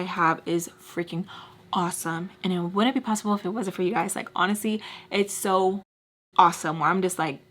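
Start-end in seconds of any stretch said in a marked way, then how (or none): none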